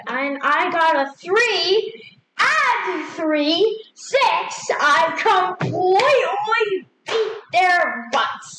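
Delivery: rising, excited tally of a winning roll; triumphant gloating. Real, not acted. Player announcing a winning dice roll, gleeful triumph. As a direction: build to a high, drawn-out crow of victory.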